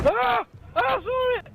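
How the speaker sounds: high panicked cry; startled, pointing it out; scared yelp. Real, not acted. Friend spots the monster.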